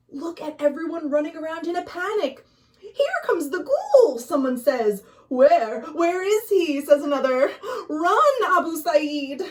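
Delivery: high, fast, frantic cries; panicked villagers. Acted. Storyteller acting out panicked villagers, high and frantic.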